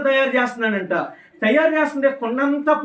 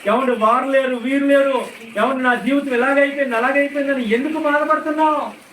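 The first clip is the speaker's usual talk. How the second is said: raised, forceful preacher's voice; fervent preaching; 4 semitones higher, 3 dB louder than usual. Real, not acted. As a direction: press the congregation with urgent questions. (ఉత్సాహభరిత ప్రసంగ స్వరం)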